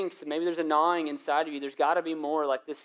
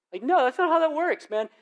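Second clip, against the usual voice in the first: exasperated; 12 semitones higher, 6 dB louder than usual. Acted. Mocking exasperated correction.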